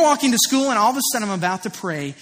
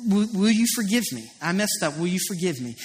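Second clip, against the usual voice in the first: soft, low, halting apology; lowered voice, asking forgiveness; retold apology; 3 semitones lower, 4 dB quieter than usual. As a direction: recount saying sorry, quietly and haltingly. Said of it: "and I apologized".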